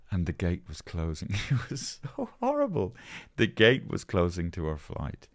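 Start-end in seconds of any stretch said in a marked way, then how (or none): none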